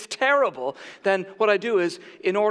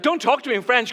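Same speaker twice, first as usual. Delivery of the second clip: raised-pitch imitation of a flustered woman; mock protest; 6 semitones higher, faster than usual. Store lady begging off French, flustered protest. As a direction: wave it off in mock alarm.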